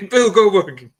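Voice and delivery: high, loud laughing exclamation. Real, not acted. Co-host echoing the friend's joke about the wrestler, laughing. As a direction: burst out the name through laughter. Laughing echo of the other speaker.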